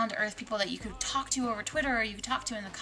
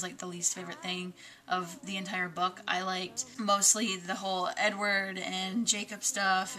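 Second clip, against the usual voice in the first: normal talking voice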